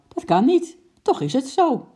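high, loud contradiction; acted marital squabble; bickering. A narrator's reading. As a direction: argue back heatedly. Acted quarrel between husband and wife.

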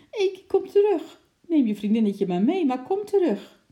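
high, loud pleading shout; alarmed calling. A narrator's reading. Girl begging her squirrel to come back, alarmed shout. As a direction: beg the pet loudly to return, in alarm. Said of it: "riep ze geschrokken".

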